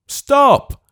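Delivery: high, loud sudden shout; cry out. Acted. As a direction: stop him at the door. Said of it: "cried Mister Haredale".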